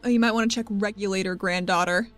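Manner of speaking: ordinary talk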